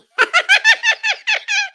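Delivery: high, loud maniacal giggle; creepy giggle. Acted. Demon voice giggling over a victim, high and maniacal. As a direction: giggle like a killer standing over someone. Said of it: "pummeling them with a ball bat and giggling".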